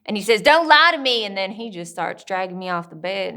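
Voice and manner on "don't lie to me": high, very loud accusation; angry father. Acted. Father accusing daughter of lying, furious shout. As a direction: yell it right in the child's face.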